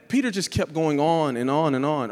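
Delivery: ordinary talk, nothing marked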